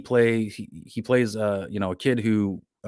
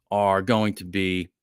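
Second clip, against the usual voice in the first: ordinary talk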